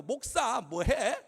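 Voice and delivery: imitated child's loud, drawn-out taunt; cheeky second-grade girl. Second-grade girl to the traffic pastor, slow cheeky taunt. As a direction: stretch it like an insolent little kid. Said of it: "초등학교 이 학년짜리 여자아이가".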